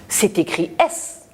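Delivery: ordinary talk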